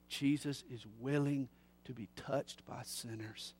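normal talking voice

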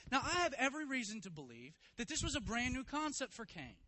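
high, loud, driving sermon; preaching at full force. Acted. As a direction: preach it hard to the congregation. Preacher presses his point.

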